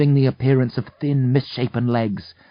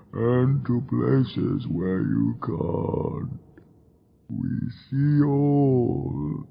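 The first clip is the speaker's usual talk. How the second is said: mournful demon; much slower than usual. A narrator's reading. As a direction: lament mortal ignorance slowly. Demon threatens heroes and crowd.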